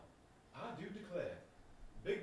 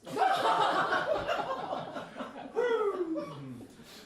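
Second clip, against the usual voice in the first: loud laugh; 9 dB louder than usual. Acted. Big laugh at accusation.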